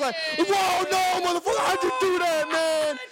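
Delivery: high loud shouting astonishment; amazed street magic reaction. Retold or imitated. Reacting to the tow guy like a street magician, high amazed shouting. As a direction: yell like you just saw a magic trick.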